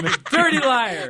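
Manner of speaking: high, laughing mock anger; laughing accusation. Real, not acted. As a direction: laugh while calling them liar.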